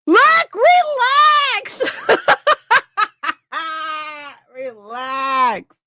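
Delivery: high, slow, loud laughing call to relax; laughing shout. Real, not acted. Calming her fired-up chat, a loud laughing shout. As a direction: shout it out while cracking up.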